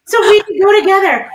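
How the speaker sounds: wife imitating husband's proud excuse; mocking the husband. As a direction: mimic him as clueless and chipper. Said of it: "That's exactly what he said".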